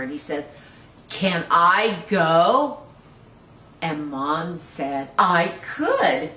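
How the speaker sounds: put-on lively read-aloud for small children; storybook reading. Teacher reading a picture book to children, lively.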